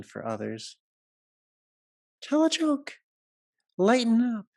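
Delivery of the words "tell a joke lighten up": high, bright exhortation to lighten up; much higher and louder playful command; playful urging. Real, not acted. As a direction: coax them to relax, teasing. Speaker urging healers to lighten.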